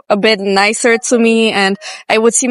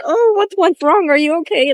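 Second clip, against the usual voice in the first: high, sweet fake-caring voice; gently checking on the cook, in mock concern; 7 semitones higher than usual. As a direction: put on an overly caring tone. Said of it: "they won't say".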